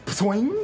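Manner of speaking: springy twang, pitched high; high, quick "ptwing" noise; imitated launch sound. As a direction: mimic a contraption being sprung. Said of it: "Then you hear this sound".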